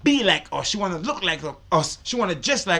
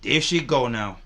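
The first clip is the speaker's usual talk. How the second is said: normal talking voice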